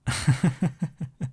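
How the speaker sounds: light affectionate chuckle; a fond little laugh. Acted. Laughs with partner.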